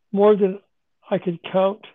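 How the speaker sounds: normal talking voice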